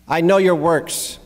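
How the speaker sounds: scripture reading. Real, not acted. Pastor reads God's words to the congregation, emphatic scripture reading.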